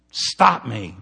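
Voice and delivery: sudden loud, firm command; emphatic demand. Acted. Overworker begging a friend to intervene, loud demand. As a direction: call out a forceful plea. Acted plea to accountability partner.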